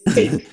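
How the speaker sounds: "Think" said as "Fink". Shown as a demonstration. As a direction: start "Think" on a Cockney F. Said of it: "T H is pronounced with a F".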